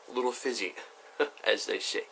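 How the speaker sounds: normal talking voice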